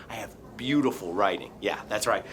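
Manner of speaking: quick low boast; smug low aside. Acted. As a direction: murmur proudly to yourself. Skit character smug aside.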